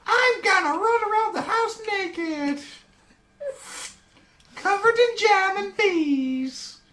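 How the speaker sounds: high, slow goofy toy voice; squeaky talking action figure; silly high character voice. Acted. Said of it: "Logan Blaze sounds like that".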